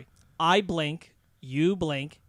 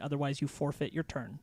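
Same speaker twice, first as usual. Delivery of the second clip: whisper; 2 semitones lower, 8 dB quieter than usual. Real, not acted. Whispered aside.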